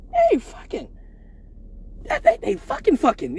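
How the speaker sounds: a stunned guard stating the obvious, high; high flat confirmation. Retold or imitated. Security guard confirms what he sees, high and falling.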